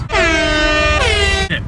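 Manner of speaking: long high shouted yeah; yelling. Real, not acted. Jeering at a passer-by, high drawn-out yell. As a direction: drag the yeah out high and loud.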